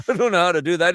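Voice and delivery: high, loud Newfoundland protest; alarmed Newfoundland-accented objection. Acted. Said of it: "jump the pond over to Newfoundland".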